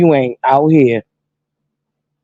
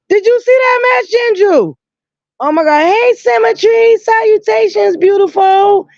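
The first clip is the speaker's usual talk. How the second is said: high, bright-voiced shout-outs to viewers; squealing hello to her fans; excited greeting. Real, not acted.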